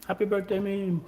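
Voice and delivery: high playful birthday cheer; sing-song self-cheer. Real, not acted. Wishing himself happy birthday.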